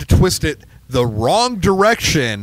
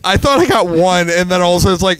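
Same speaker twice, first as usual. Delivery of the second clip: ordinary talk, nothing marked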